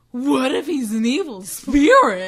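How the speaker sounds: hammed-up spooked question; paranoid heroine. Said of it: "automatically he's like".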